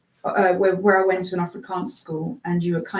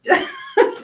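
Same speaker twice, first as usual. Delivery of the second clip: laugh. Real, not acted. Laughs mid tongue twister.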